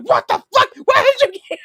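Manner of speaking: a brother startled by the surprise visit; shocked shout. Retold or imitated. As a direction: shriek it out in disbelief. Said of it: "He's like".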